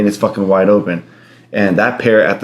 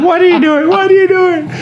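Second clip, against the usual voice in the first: very high squawking bird voice; parrot impression. Owner imitates his macaw for laughing friends, squawky. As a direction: screech it out like a talking parrot. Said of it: "Yo, that parrot".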